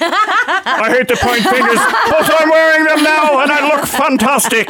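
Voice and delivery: high-pitched, drawn-out showy impression; Robin Leach. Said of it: "I think it was Robin Leach".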